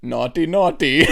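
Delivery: slow, high mock scolding in accent; Borat. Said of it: "And then he goes".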